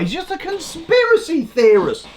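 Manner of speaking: high, sneering put-down of a critic; sneering mock voice. Speaker mocks critics who label him, sneering high. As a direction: mimic a smug accuser.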